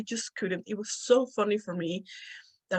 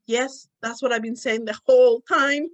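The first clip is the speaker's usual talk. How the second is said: raised, louder, emphatic insistence; mock exasperation; 4 semitones higher, 6 dB louder than usual. Speaker to friend who finally got it, exasperated insistence. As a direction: insist as if misunderstood all along. Exasperated reply in retold misunderstanding.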